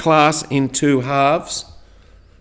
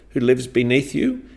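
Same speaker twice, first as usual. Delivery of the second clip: usual speaking voice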